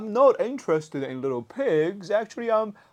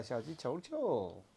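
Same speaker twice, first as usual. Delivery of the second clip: ordinary talk, nothing marked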